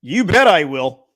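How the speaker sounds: high, loud impression of a sitcom character; Carlton Banks. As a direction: belt it out in a hammy character voice. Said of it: "Carlton Banks voice".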